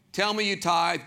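ordinary delivery

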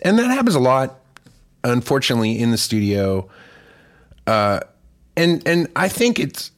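ordinary talk

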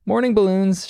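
high, lilting morning hello; Bluey's cheerful greeting. Acted. Voices Bluey's cheerful waking greeting.

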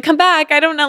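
high, pleading come back; mock plea. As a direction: raise the pitch a lot and beg lightly. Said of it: "I was like".

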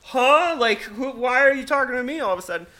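put-on high, fast baffled drunk rambling; imitating drunk man. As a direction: rattle it off confused. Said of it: "And he'd be like".

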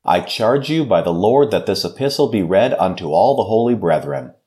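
normal talking voice

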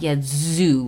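put-on Baltimore accent. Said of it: "they drag out their words".

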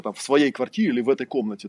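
ordinary talk, nothing marked

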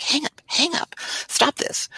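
high, hushed mock urgency; retold urgent plea. Retold urgent interruption of boss.

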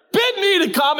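high, hammed-up stage plea; mock Romeo and Juliet. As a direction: declaim it like Shakespeare. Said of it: "just turn into Romeo and Juliet".

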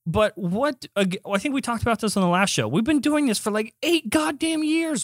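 high-pitched exasperated rant; worked up. Real, not acted. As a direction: protest heatedly, stretching the key words. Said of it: "You need to cool down".

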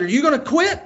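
high-pitched retold challenge; challenging. Dad to son struggling in a plank, challenging.

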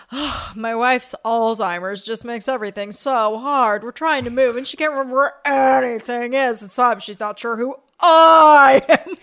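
woeful lament building to a loud wail; victim voice. Retold or imitated. Imitating a classmate's example about his wife, hammed-up victim wail. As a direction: pile on the misery, wailing the last word. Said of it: "I could tell it like this".

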